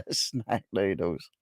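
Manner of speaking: slow amused repeat through laughter; laughing. Real, not acted. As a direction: chuckle the words out slowly. Mocks the term, laughing.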